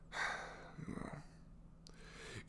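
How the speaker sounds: long, soft sigh; weary sigh. Acted. Villains' inventor resigned to family life, weary sigh. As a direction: let out a slow, quiet sigh.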